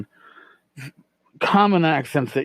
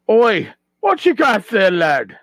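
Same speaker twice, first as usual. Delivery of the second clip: loud, higher gruff-style dwarf greeting; dwarven barterer; 7 semitones higher, 5 dB louder than usual. Shown as a demonstration. Game master voicing the dwarven barterer greeting a customer, loud call. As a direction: hail the customer like a burly dwarf trader. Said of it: "your dwarven barterer".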